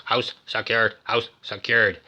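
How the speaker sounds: robotic repeated announcement; flat machine chant like a house system; crazy computer voice. Retold or imitated. Recapper imitating the house computer's crazy robotic voice. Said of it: "crazy computer voice".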